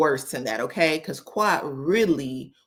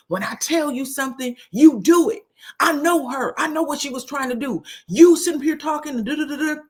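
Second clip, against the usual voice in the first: much higher, bursts louder, heated scolding; a husband laying down the law to his wife; retold yelling; 8 semitones higher, 4 dB louder than usual. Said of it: "he ends up screaming at Sweet Tea".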